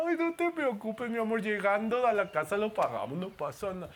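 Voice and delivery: mock-sweet bride calming her husband; doting wife voice. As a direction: soothe him like a doting new bride. Newlywed wife voiced reassuring.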